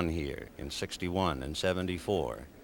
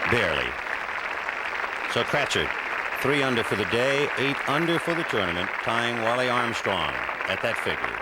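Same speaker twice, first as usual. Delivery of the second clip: excited commentary; 5 semitones higher, 6 dB louder than usual. Real, not acted. Putt drops, crowd roars.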